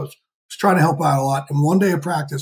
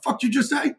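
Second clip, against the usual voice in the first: mock challenge; 9 semitones higher, 3 dB quieter than usual. Glaring at players.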